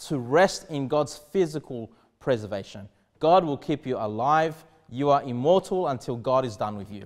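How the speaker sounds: ordinary delivery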